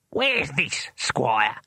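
squeaky imp. A narrator's reading. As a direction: ask as a tiny squeaky imp. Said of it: "small and squeaky voice".

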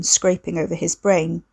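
plain reading voice

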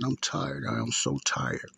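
mildly weary imitation, dropping low at the end; imitating tired man. Retelling the young man's phone call, imitated weariness sinking low. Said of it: "that's how he sounded".

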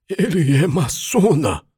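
high, loud frightened blurt; startled cry. A narrator's reading. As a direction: blurt it out in shock. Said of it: "o matuto soltou".

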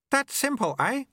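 very high, chirpy character voice; eager apprentice. A narrator's reading. Apprentice before time stutters.

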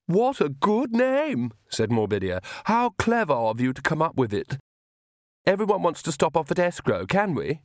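put-on girlish enthusiasm; affected vampire girl. A narrator's reading. Morbidia gushing at an introduction.

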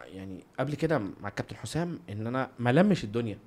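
ordinary delivery

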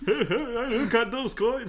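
high, slow, laughing cartoon plumber; Mario voice. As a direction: giggle through it like Mario. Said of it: "Comme ça, Mario fait".

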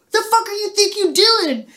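high, sharp yell at the driver; furious confrontation. Retold or imitated. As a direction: demand an answer angrily.

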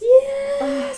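loud high yes; excited. Real, not acted. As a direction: shout it, overjoyed. Thrilled about voice actors.

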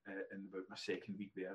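ordinary talk

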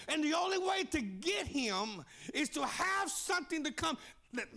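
normal talking voice

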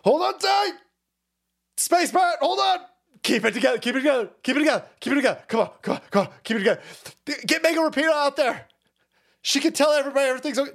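high, frantic hammed-up urging; rally the crew in comic alarm; mock panic. Imitating those holding the economy together, high panicked voice. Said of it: "they're like".

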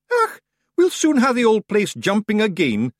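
high, loud cheeky Scottish; grinning wee Scotsman; grinning Scottish accent. Acted.

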